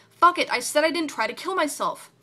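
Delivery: loud, high angry retort; angry outburst. Acted. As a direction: snap back in fury.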